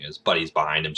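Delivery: normal talking voice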